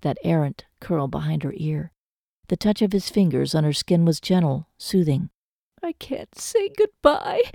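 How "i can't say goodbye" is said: high, slow, tear-choked woman; tearful. A narrator's reading. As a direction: draw it out, barely holding back sobs. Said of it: "she clung to him and sobbed".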